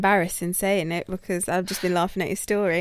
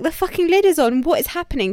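retold panic; 6 semitones higher than usual. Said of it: "But I was like".

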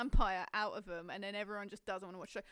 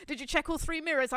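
mock driving instructor; 4 semitones higher, 8 dB louder than usual.

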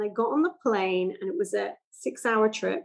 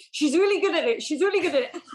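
higher-pitched imitation of boasting parents; proud parents; 7 semitones higher, 3 dB louder than usual. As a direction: mimic parents showing off their child. Voices parents showing off their child.

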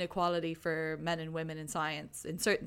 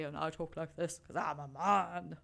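mock man voice; 2 semitones lower than usual. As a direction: caricature how a man supposedly talks. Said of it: "I talk like this 'cause I'm a man".

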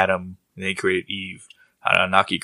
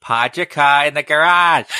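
put-on Boston accent through laughter, higher and slow; slow, hammy Boston accent, cracking up; Boston accent breaking into laughter; laughing Boston accent; 6 semitones higher, 5 dB louder, slower than usual. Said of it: "That's my Boston accent".

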